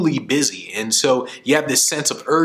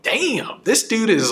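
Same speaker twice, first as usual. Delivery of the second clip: impressed exclamation; 9 semitones higher, slower than usual. Retold or imitated. Speaker's impressed thought.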